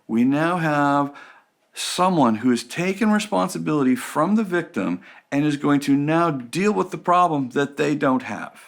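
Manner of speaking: ordinary talk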